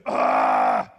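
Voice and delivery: very low, loud disgusted groan; teen's groan. Retold or imitated. Daughter groaning at the cheer group, low and loud. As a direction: groan it out like a fed-up teenager. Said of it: "she goes".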